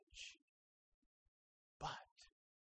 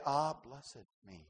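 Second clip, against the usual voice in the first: softer, lower, slow grateful sigh; contented blessing; 6 semitones lower, 6 dB quieter than usual. Retold or imitated. Preacher modelling a grateful persecuted believer, calm and content. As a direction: savour it like a gift received.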